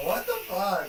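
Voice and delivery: ordinary talk, nothing marked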